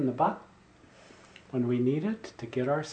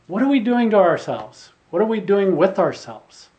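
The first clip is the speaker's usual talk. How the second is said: loud, raised exhortation; rousing challenge; 6 semitones higher, 9 dB louder than usual. Acted. Preacher turning the swami's question on the congregation, rousing challenge. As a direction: challenge the whole room to wake up.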